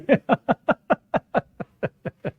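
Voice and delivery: long, high real laugh; laughter. Real, not acted. Teacher amused by a student's answer, laughing on and on.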